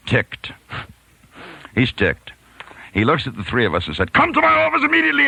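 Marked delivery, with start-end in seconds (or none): 4.1-5.3 very high, loud, fast furious order; in a rage. Retold or imitated. Principal ordering the boys to his office, mock furious. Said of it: "in a rage".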